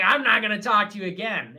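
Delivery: loud, bratty mock bitch voice. Imitating a woman cutting him off, loud bitch voice. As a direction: imitate her, loud and snotty. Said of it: "in a bitch voice".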